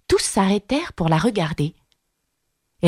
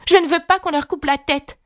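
put-on high-pitched girlish protest; exclaiming; 11 semitones higher than usual. A narrator's reading. Alice protests to save the gardeners: high exclamation. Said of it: "s'exclama-t-elle".

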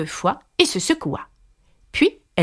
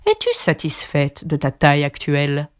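caterpillar voice; 7 semitones lower than usual. Acted. Caterpillar voiced in read story.

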